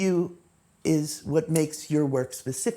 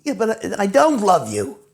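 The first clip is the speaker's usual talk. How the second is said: rejecting reply; 4 semitones higher, 6 dB louder than usual. Acted. Acted reply to confession.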